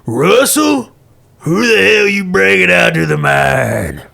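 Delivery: loud, challenging outlaw boss; gruff gang boss. Acted. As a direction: call out the stranger roughly. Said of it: "a big man ... crosses arms".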